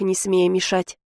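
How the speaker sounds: ordinary delivery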